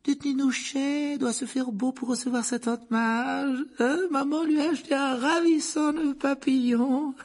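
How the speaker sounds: put-on very high, squealing baby talk; doting aunt. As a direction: coo shrilly over a darling son.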